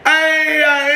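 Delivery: squeaky high intro voice; goofy high voice. Acted. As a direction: pitch it way up for laughs. Vlog intro in put-on voice.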